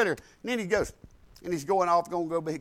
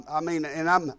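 ordinary delivery

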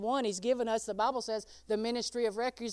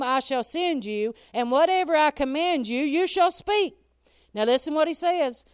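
louder, higher proclaiming of God's words; full-force preaching; 3 semitones higher, 9 dB louder than usual. Acted. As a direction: thunder the promise to the church.

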